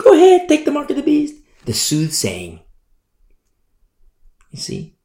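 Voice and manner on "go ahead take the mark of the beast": much higher, louder and fast, a sneering taunt; hammed-up tempter, high and quick; mocking tempter. Preacher mocks Satan's servants, high and fast. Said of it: "Satan's servants voiced".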